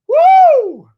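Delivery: ordinary talk, nothing marked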